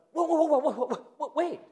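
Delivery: sudden laughing double take, stopping himself short; surprised whoa. Real, not acted. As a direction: catch yourself mid-thought, amazed and amused. Preacher stops himself, amazed.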